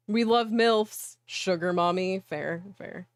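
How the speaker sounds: ordinary talk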